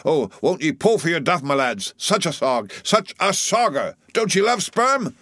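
high-pitched, frantic pleading with rowers; excited cry. A narrator's reading. Flask pleads with his lads to row harder, excited cry. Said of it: "cried Flask, dancing up and down".

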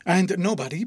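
ordinary delivery, nothing marked

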